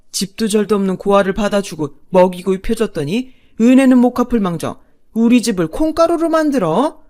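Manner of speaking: loud, blustering rage; father-in-law's angry outburst. Acted. Father berating his son and wife, bursting with anger. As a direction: roar at your son and wife. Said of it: "아버님이 버럭 소리쳤죠".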